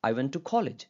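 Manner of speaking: "college" stressed, with a strong opening k; "I went to" light, weight landing on "college". Shown as a demonstration. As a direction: make "college" the prominent word. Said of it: "अगर मैं college पे stress देना".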